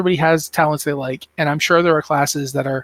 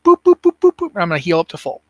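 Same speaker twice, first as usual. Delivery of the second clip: boop sound effect; 3 semitones higher, 5 dB louder than usual. Retold or imitated. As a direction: chirp out each heal tick. Imitating healing tick sound.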